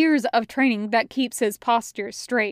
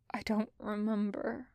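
very soft, subdued voice; quiet admission; 10 dB quieter than usual. A narrator's reading. Drugged cadet admitting memory loss to his brother, very quiet. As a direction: say it barely aloud.

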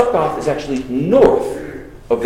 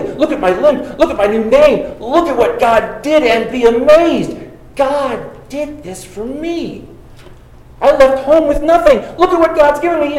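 mock boastful Jacob; 7 semitones higher than usual. Preacher voicing Jacob bragging to his brother, mock boastful. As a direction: show off everything God gave you.

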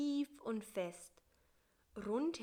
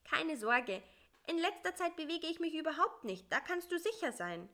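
small, high and fast; a little fly hurrying to reassure; high, quick fly voice; 8 semitones higher, faster than usual. Acted. Bissi promises to keep still, high and quick.

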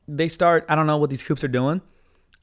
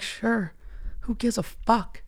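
breezy indifference; 7 semitones higher, 7 dB quieter, much slower than usual. Retold or imitated. Mock easygoing customer.

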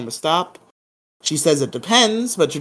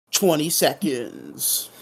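slow, drawn-out count; mock-suspense countdown; much slower than usual. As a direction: stretch each word for mock suspense.

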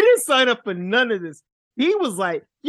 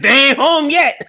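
louder, higher warning; loud teasing warning; mock warning; 6 semitones higher, 7 dB louder than usual. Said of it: "It's like".

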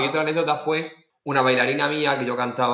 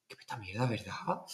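very soft confidential remark; hushed, conspiratorial complaint; retold discreet aside; 3 semitones lower, 12 dB quieter than usual. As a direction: mutter it quietly to a partner. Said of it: "le decía, digo".

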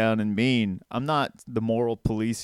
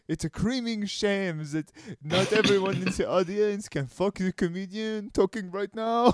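higher-pitched mock French lament; hammed-up French accent, pitched up; 7 semitones higher than usual. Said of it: "My family's French. I'm allowed to do that".

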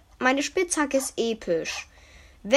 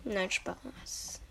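whispering; 2 semitones lower, 9 dB quieter than usual. Real, not acted. Whispered joke retraction.

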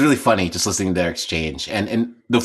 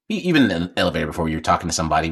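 ordinary talk

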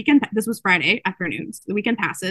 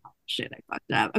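hushed low whisper of dismay; panicked whisper; 10 semitones lower, 9 dB quieter than usual. Retold or imitated. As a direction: whisper it, caught out. Violet panics after mistake.

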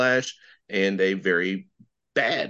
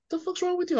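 higher, mock incredulous question; 4 dB quieter than usual. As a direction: echo a baffled friend, pitched up. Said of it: "Michelle was like".

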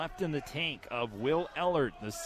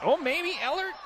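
sudden high excited shout; surprised yelp; 8 dB louder than usual. Real, not acted. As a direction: jump up in surprise. Sudden scramble near match end.

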